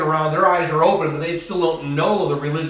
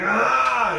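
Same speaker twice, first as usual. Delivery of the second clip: drawn-out, higher-pitched mock cry of pain; imitated labor cry; 7 semitones higher than usual. Preacher shows congregation what crying out means, imitated labor wail. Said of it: "throngs of labor pain, you're like".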